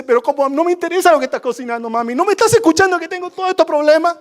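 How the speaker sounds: high, loud, fast whining daughter; upset daughter. Retold or imitated. Teenage daughter protests to her cooking mother, high, loud and fast. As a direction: plead in a high, loud rush.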